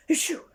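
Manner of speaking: loud high sneeze. Acted. Miss Stubborn sneezing in Mister Strong's kitchen, loud and high. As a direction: let out a big achoo. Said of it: "sneezed little Miss Stubborn".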